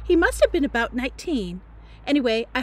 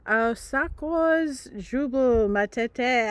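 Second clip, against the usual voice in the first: fake French; much slower than usual. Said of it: "only speaking French".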